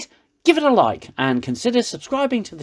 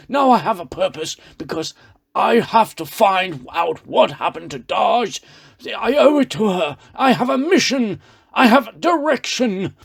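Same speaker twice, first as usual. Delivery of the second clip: mock Picard; 5 semitones higher than usual. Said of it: "And Picard goes".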